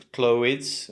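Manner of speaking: "clothes" split as CLOH-idz; students' wrong clothes. Retold or imitated. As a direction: imitate the mistake, "clothes" as clo-widz. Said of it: "make mistakes with that final E S".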